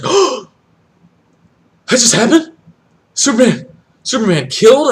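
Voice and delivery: high, loud shocked disbelief; stunned disbelief. Retold or imitated. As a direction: react like you can't believe it. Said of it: "I was just like".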